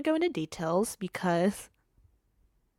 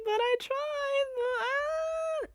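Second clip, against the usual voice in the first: whiny excuse. Pretend. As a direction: plead weakly in her own defence. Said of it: "I'm like".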